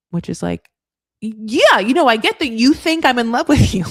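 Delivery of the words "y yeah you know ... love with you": exasperated mock retort. Host mimics exasperated rebuttal.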